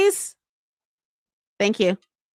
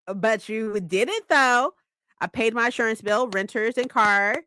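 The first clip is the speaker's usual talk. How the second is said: ordinary talk, nothing marked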